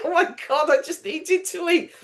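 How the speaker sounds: high, laughing flustered excuse; embarrassed excuse. Retold or imitated. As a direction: giggle it out, caught eating. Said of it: "I was like".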